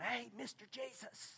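hushed whisper, almost no voice. Shown as a demonstration. Lepers timidly whispering to Jesus, hushed whisper. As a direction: breathe it out under your breath. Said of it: "it doesn't say they whispered".

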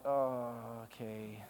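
low, drawn-out, soft hesitant okay; reluctant agreement. Retold or imitated. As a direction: drag out a doubtful, reluctant okay. Reluctant answer to the Lord.

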